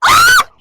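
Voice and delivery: very high, loud shriek; piercing frightened scream; real scream. Real, not acted. Screams after biting.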